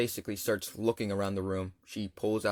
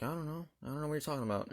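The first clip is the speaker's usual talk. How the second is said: soft, higher-pitched sister imitation; sister's denial; 5 semitones higher, 9 dB quieter, much faster than usual. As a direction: play innocent in a small raised voice. Sister denies to mom.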